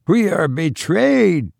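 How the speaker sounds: a conspirator cries out in alarm; called out. A narrator's reading. Calling out in alarm. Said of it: "called a voice".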